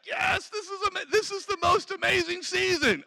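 high-pitched acted enthusiasm; excited. Believer embracing a season of training, excited. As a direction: play an overjoyed believer.

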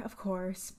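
normal talking voice

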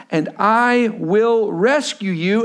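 very slow, each word weighed; slow emphatic reading. Real, not acted. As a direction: lean on every word of the promise. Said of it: "Look at this".

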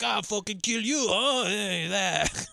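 imitating the thug, high and strained; mock thug threat. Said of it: "the guy's like".

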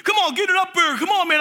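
high-pitched mock scolding; dad yelling. Dad yells at son to lift the block, mock scolding. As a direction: imitate a dad yelling impatiently. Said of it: "my dad's yelling at me".

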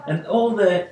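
normal talking voice

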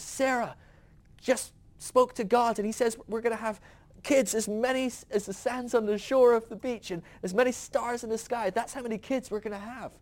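high, eager retelling of good news; husband rushing to tell his wife, high and excited; excited Abraham. Retold or imitated. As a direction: burst in with the big promise. Abraham excitedly telling Sarah the promise.

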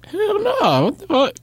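high-pitched shocked refusal; appalled. Real, not acted. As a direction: recoil in disbelief, voice shooting up. Recoils at a crude phrase.

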